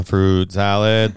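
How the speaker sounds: slow, stretched-out fruit salad; drawn out. Pretend. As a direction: stretch every syllable slowly. Drawn-out silly phrase.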